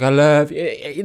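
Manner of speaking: slow, hammed-up lover; drawn-out melodramatic love poem; mock dramatic. Host imitating lovers' poems to each other, mock dramatic. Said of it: "They were so dramatic".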